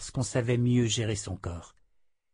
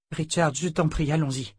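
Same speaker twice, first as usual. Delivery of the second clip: higher, lightly acted plea; understated imploring woman's voice; pleading heroine; 5 semitones higher than usual. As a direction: gently beg your husband to get on with it. Heroine begs her husband to go.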